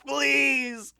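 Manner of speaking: ordinary talk, nothing marked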